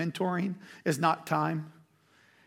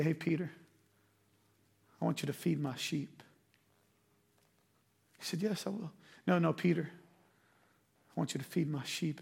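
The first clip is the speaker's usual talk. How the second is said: tender Jesus; 2 semitones lower, 8 dB quieter than usual. Acted. Jesus to Peter after denial, soft and tender. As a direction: speak it softly to a friend who failed you.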